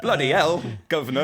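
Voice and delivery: high, loud over-the-top cockney "Bloody hell, governor"; mock cockney British. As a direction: ham up the London cabbie cliché. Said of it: "the voice coming out was like".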